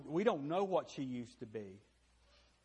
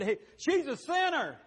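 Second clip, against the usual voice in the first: high, loud sneering accusation; mock accuser; 11 semitones higher, 8 dB louder than usual. Pastor mocking the room's accuser, high and loud. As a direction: fling the accusation like a gossip. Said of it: "She said".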